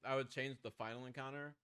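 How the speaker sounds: talking through a mouthful of popcorn; munching popcorn. Real, not acted. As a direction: chew while you talk. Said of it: "sorry, I'm eating popcorn".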